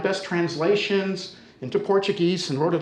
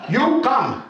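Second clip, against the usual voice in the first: loud, high command in few-word English; forceful imitation of an imperious woman; bossy neighbour; 8 semitones higher, 5 dB louder than usual. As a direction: order him along like a no-nonsense neighbour. Teller voices the formidable neighbour ordering him.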